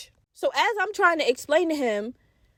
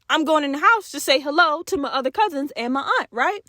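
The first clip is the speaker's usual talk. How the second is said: ordinary talk, nothing marked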